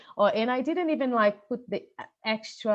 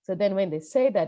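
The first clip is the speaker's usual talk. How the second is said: usual speaking voice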